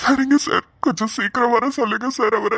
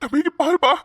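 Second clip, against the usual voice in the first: higher, sobbing calls to a brother who won't wake; crying out; 4 semitones higher than usual. Acted. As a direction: break into tears calling his name. Brother weeps over unconscious sibling. (அழுதுகொண்டே தம்பியை அழைத்தல்)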